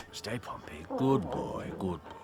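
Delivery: whispered praise to a dog; hushed whisper to a good dog. Acted. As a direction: soothe the dog without a sound. Hushing the dog near the cottage.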